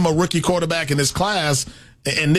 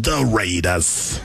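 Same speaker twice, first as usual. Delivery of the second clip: The Raiders slow and much lower; Chris Berman impression; 8 semitones lower, much slower than usual. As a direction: drag out the team name like a TV anchor. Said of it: "Is that how he says it?".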